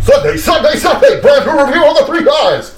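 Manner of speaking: wrestling promo shout. Pretend. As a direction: hype it like a monster truck ad. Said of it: "You're cutting into an eighties wrestling promo now".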